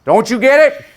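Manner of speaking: high, loud preacher-like challenge; Martin Luther King Junior. Retold or imitated. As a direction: imitate a boy orator calling out to the class. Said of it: "Howard spoke like Martin Luther King Junior".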